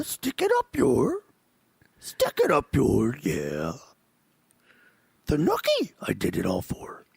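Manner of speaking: slow croaky Yoda on song lyrics, whispered end; Yoda doing [name]. Pretend. Host sings [name] lyrics as Yoda for cohost, mock Yoda. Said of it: "let me do Yoda doing [name] quotes".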